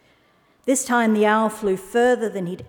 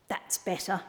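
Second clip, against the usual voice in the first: quiet satisfied mutter; 10 dB quieter than usual. Acted. Owl alone, content.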